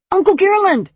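put-on high-pitched eager boy's cry; over-enthusiastic. A narrator's reading. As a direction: burst out eagerly as an excited nephew. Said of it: "with his insufferable enthusiasm".

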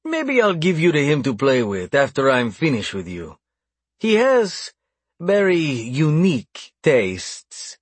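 high-pitched gloating, lingering on the last words; taunting. A narrator's reading. Captor offering captive to his brother, taunting. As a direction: dangle the threat teasingly, drawing out the end. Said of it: "he taunted".

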